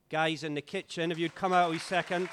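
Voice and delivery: loud call across the hall to the kitchen; summoning the kitchen team with a loud call. Real, not acted. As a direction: call them out from the back room. Calling kitchen helpers to come out.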